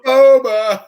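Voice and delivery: loud, high answering call; calling back. Pretend. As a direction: shout the reply like the pool game. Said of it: "walking around the park going".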